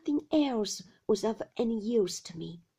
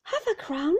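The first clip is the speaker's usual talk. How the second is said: recovering from fright; 7 semitones higher, 6 dB louder than usual. A narrator's reading. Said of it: "recovering myself".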